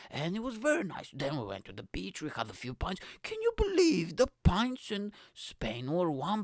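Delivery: a lightly voiced mock holiday bore. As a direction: parody a man boasting about cheap pints. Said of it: "He's gonna talk his stupid shit".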